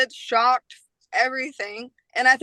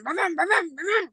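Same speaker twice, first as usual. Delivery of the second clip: motorbike vroom; 6 semitones higher than usual. Retold or imitated. Imitating motorbike arriving.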